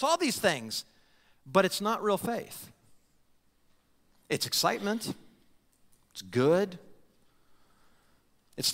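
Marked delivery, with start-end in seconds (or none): none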